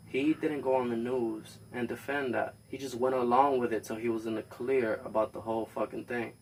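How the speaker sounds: usual speaking voice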